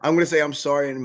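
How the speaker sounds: loud, high-pitched real laughing confession; laughing protest. Real, not acted. Fighter to host teasing him about swearing, laughing protest. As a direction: protest through laughter, voice jumping up.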